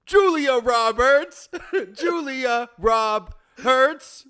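laughing. Real, not acted. Laughing while reading names.

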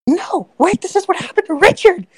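high-pitched, frantic plea; panicked kobold. Acted. Kobold warning his charmed friend, panicked. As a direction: cry out in hammed-up alarm.

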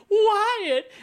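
high, loud mock wail of Wyatt; mock scream of wyatt. Podcaster imitating Dana Delany running to Wyatt, mock scream. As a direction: shriek his name across the rain. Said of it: "she's like".

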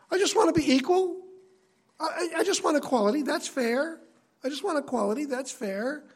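high, petulant demand for equality; whiny rebel. Acted. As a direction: whine for fairness like a sulky child. Rebel voiced whining for equality.

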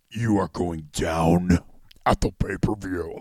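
slow, very low mock tough-guy voice; put-on deep voice, heavy and slow; forced deep voice. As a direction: drag it out in a fake deep voice. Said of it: "he has this forced deep voice".

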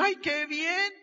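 high naive delight; pleased. Retold or imitated. Naive young man agreeing to pay the saleswoman, high and delighted. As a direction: chirp it like a happy fool.

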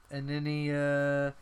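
normal talking voice

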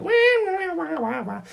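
high sliding wah wah like a pedal steel; steel guitar imitation. Explaining country to a friend, imitating a steel guitar, high and sliding. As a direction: copy the twang of a steel guitar with your voice. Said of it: "it's like the steel guitar".

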